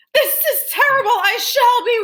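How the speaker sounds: high, loud panicked shout; high loud shout of ruin. A narrator's reading. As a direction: cry out in despair, tearing your beard. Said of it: "tore his beard and shouted".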